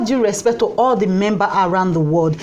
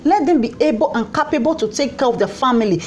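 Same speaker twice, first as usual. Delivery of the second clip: ordinary talk, nothing marked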